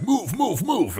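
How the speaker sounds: high-pitched mock "coming through" call; quick repeated order, pitched well up; imitating Bob's urging. Retells Bob clearing his way.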